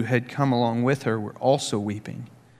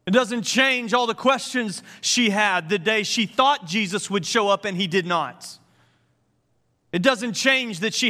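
impassioned preaching; 9 semitones higher than usual. Acted. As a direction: press the point with passion. Preaching at full force.

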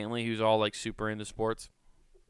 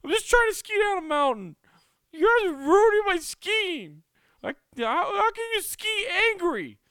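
very high, loud whiny complaint; exasperated whine; 8 dB louder than usual. Retold or imitated. Fed-up son whining at family ruining his skiing trip. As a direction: whine like you're fed up with them.

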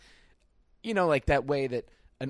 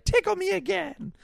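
much higher playful squeaky plea; imitating boyfriend. Teller mimics Jack begging for more tickles, squeaky high. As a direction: squeak it like a giddy kid. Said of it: "Jack will be like".

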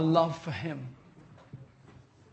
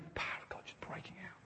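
sudden whisper; 20 dB quieter, faster than usual. Real, not acted. Preacher awed by the power breaking out, whispering. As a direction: drop to a hushed whisper of wonder.

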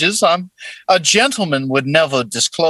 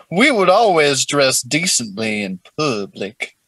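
put-on Southern accent; 2 semitones lower than usual. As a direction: play a courtly old-South gentleman with a Southern accent. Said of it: "hearing your southern accent".

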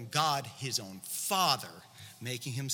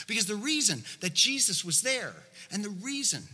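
ordinary talk, nothing marked

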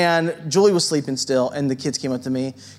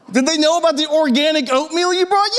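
much higher, incredulous mocking repeat; mocking echo; 11 semitones higher than usual. Pastor mocks his wife's oatmeal question, high and incredulous. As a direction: parrot her question back in disbelief.

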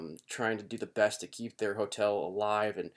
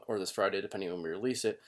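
ordinary delivery

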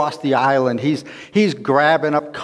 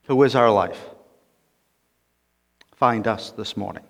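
prayer; 4 semitones lower than usual. Acted. Pastor praying over the congregation, reverent. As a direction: pray it gently with pauses.

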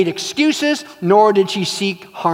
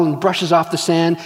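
normal talking voice

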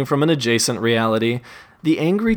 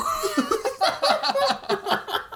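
manic laugh; 6 dB quieter than usual. Acted.

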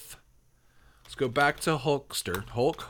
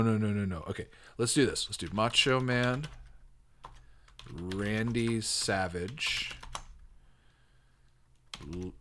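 normal talking voice

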